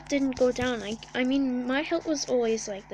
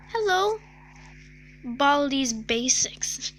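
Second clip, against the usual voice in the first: ordinary delivery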